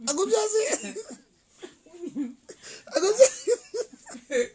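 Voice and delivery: high, tearful laughing voice; too overcome with giggles to finish; crying with laughter. Real, not acted. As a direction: squeak the words out through laughter. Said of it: "Sorry, I'm crying".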